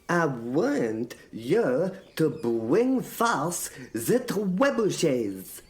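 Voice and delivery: very slow order, "trebuchets" stretched out; repeated stress on TREBUCHETS. A narrator's reading. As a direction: spell out the order slowly, leaning on "trebuchets". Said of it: "I repeated the emphasis on trebuchet".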